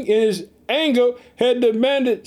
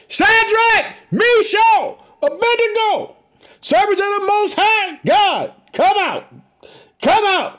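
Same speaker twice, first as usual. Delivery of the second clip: very high, drawn-out calling shout; shouted summons; 12 semitones higher, 4 dB louder, slower than usual. A narrator's reading. King calling the three men out of the furnace, shouting. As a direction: call each name out at full stretch, high. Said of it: "furnace and shouted".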